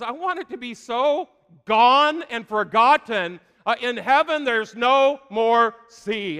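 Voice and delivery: voicing God.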